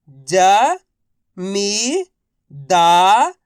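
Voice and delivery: राजनीतिक drawn out one syllable at a time. Shown as a demonstration. Teacher sounding out राजनीतिक for learners. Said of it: "तो मिलके बन जाएगा".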